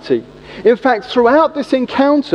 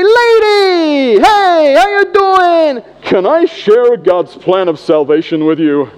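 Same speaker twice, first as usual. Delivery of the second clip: much higher, loud hammed-up calls to an old lady; cringeworthy extrovert; 6 semitones higher, 4 dB louder, slower than usual. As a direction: overeager street evangelist, loud and fake. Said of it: "you say".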